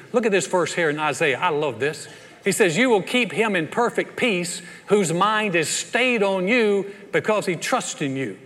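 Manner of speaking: loud, emphatic sermon voice; forceful preaching. Real, not acted. Preacher urging the congregation onto God's promises, forceful preaching.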